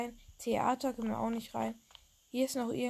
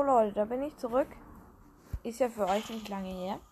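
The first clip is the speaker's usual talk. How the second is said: ordinary talk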